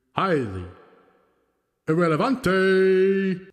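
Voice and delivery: slow drawn-out ring announcer, echoing; announcer with reverb. Pretend. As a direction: stretch the name out like a wrestling emcee with reverb. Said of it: "Luchador announcer style".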